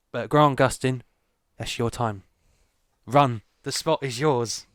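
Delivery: loud, slow call to action; rallying call. Pretend.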